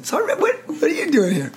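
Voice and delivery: high, amazed imitation of an old friend; shocked recognition. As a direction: retell a buddy's stunned double take. Friend shocked to see him.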